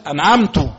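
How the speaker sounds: أنعمت said as anʕamtu, ending in u; أنعمت with the last ta voiced as tu; أنعمت mispronounced, ending on u; wrong damma on ta. Shown as a demonstration. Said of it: "وضم تاء أنعمت".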